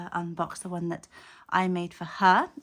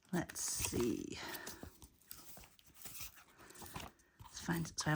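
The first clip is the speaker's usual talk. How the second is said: winded, out of breath from the stairs; breathless after running upstairs; 2 semitones lower, 10 dB quieter than usual. Real, not acted. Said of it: "I'm out of breath because I was running".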